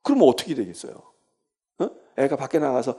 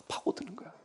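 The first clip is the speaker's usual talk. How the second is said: hushed, breathy whisper of burrowing in; 6 semitones higher, 13 dB quieter than usual. Acted. Preacher whispering to congregation how a child burrows in. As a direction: drop to a whisper, almost secret.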